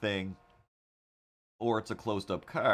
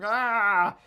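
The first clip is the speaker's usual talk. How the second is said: toy scream; 12 semitones higher, 8 dB louder than usual. Retold or imitated. Toy face screaming from chest.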